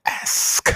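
"Ask" spelled out crisply, breathy and loud; a deliberate "Ask"; careful pronunciation. Shown as a demonstration. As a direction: land "Ask" clean with a hard final k. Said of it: "That's like that word ask".

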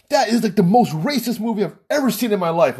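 mildly indignant retelling, pitched high; pissed off. Retold or imitated. Friend condemns the movie to his buddies, mildly pissed off. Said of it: "he was just pissed".

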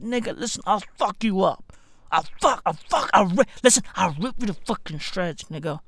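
low-volume mock menace; soft, stammered tough talk; acted threat. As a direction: threaten the dog under your breath.

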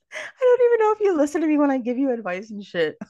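high, laughing words; laughing at herself. Real, not acted. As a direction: giggle through the confession. Laughing through self-deprecating admission.

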